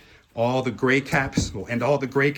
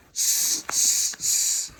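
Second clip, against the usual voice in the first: sharp voiceless monkey "tss"; monkey chatter; much slower than usual. A narrator's reading. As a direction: imitate monkeys with short hisses. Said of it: "back at him and said".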